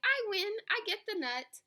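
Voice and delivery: high, gleeful boast; delighted. A narrator's reading. Hedgehog claiming victory in the contest, delighted. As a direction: crow over the win.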